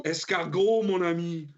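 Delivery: high, hammed-up French accent; mock French accent. Friend joking about French food, mock French accent. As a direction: put on a jokey French accent.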